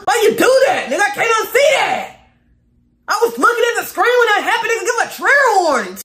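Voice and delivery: yells he can't unsee it; disgusted yelling. Real, not acted. Streamer yells in disgust at what the contestant did.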